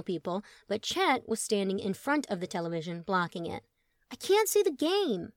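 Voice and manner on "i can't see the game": whiny complaint. Acted. Truman complaining.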